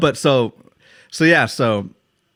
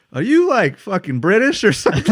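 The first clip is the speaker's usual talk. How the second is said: much higher, louder, disbelieving; baffled stranger; 9 semitones higher, 5 dB louder than usual. Retold or imitated. Drunk night in Spain, stranger baffled by the slang. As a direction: ask it like you can't believe your ears. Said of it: "the guy was like".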